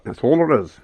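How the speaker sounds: ordinary talk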